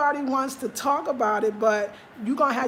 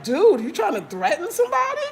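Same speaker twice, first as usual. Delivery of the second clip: incredulous mock challenge; 7 semitones higher, 4 dB louder than usual. Mocking a commenter who sounds like he is threatening her, higher and louder. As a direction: pitch jumps up, louder, a mocking "are you serious" voice. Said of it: "I was like".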